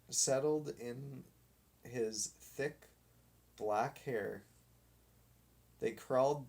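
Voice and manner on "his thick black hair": usual speaking voice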